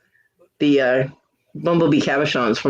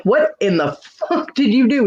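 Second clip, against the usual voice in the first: higher-pitched incredulous demand; mock disbelief; 8 semitones higher than usual. Seeing cactus all over his shirt, retold incredulity. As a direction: retell it as a baffled scolding.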